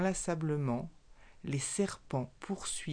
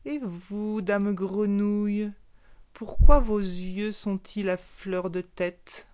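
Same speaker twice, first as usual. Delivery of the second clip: slow, much louder, mocking question; annoyed snake; 3 semitones higher, 7 dB louder, slower than usual. Annoyed snake mockingly questions the frog, slow and loud. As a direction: turn the questions back on her, slow and loud. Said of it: "Très agacé".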